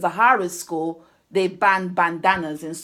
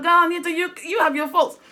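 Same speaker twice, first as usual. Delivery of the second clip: laughing self-scold; 7 semitones higher than usual. Retold or imitated. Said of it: "I said to myself".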